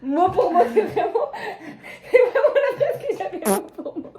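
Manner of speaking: high, loud, laughing disbelief. Real, not acted. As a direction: burst out laughing in amazement. Friend guessed her exact words.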